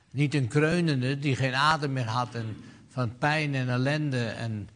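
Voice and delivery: normal talking voice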